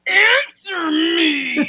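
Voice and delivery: high, strained, slow mock shriek; hammed-up squeezed scream of command; constipated aggression. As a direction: imitate a straining, furious demand. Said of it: "That's very good, [name]. She does get those constipated aggressions".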